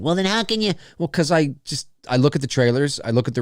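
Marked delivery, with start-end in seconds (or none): 0.0-0.7 imagined viewer objecting. Acted. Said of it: "I know what you're probably thinking".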